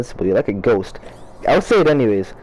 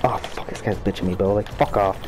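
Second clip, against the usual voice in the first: quiet grumble under the breath; annoyed mutter; 6 semitones lower, 7 dB quieter than usual. Real, not acted. Muttering while losing.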